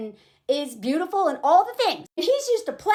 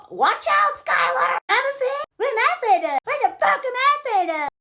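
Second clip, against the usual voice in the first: much higher, hammed-up indignation; mock outraged outburst; 11 semitones higher than usual. Man called afraid lashing out at Skylar, mock fury. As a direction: imitate a fragile ego blowing up, building to the swear. Acts out fragile ego's outburst.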